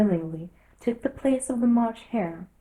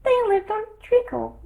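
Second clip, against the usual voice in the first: high, small dormouse voice; a tiny drowsy creature telling a tale; sleepy little dormouse; 14 semitones higher, 4 dB louder than usual. A narrator's reading. Dormouse voice held.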